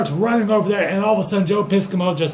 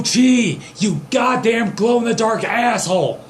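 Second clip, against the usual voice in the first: higher-pitched, exasperated berating; outraged rant; 4 semitones higher than usual. Acted. Kipps berates Joe for overdoing the attack, outraged rant. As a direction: scold him in mounting disbelief.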